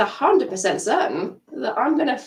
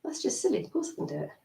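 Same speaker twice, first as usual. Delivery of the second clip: quiet dismissal; 3 semitones higher, 9 dB quieter, faster than usual. Shown as a demonstration. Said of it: "you want your inner voice to go".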